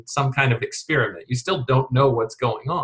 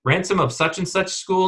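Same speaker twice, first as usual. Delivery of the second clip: rising, higher, mock surprise; surprised recognition; 7 semitones higher than usual. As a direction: retell his astonished double take. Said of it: "And he's like".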